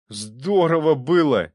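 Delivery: loud, high, drawn-out gusto; hearty, elated cry; cheerful exclamation. A narrator's reading. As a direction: relish it loudly and merrily. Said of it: "весело сказал Боретинский".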